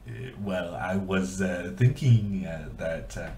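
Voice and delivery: halting, generic French accent. Shown as a demonstration. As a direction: fumble through a stock French accent. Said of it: "kind of a generic French accent".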